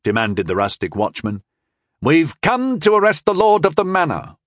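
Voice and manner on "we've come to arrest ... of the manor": commanding, higher and louder; a boy playing the stern official; authoritative. A narrator's reading. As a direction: announce it like an officer of the law. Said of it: "Walvin said, authoritatively".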